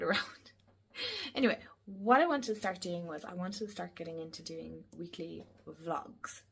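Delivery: ordinary talk, nothing marked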